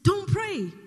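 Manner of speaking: loud, high order to stop; firm command. Retold or imitated. Holy Spirit stopping a would-be prayer, firm command. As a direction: cut in forcefully with a command.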